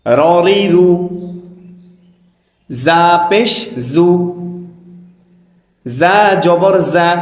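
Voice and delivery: slow, measured teacher's chant; spelling drill recitation. Shown as a demonstration. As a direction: recite each letter deliberately, vowel drawn out. Leads a letter-spelling drill.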